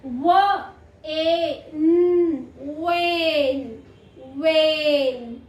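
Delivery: slow sound-by-sound blend of व, ए, न into वेन. Shown as a demonstration. Teacher showing pupils how to read वेन, drawn-out phonics blending. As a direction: stretch each sound long and firm, then blend "वेन". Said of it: "जैसे अब कैसे पढ़ेंगे".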